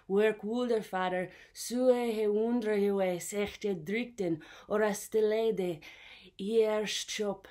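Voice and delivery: put-on forceful, slow Old English recitation; proper Old English. Shown as a demonstration. Teacher showing what proper English is, declaimed Old English. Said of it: "I'll give you proper".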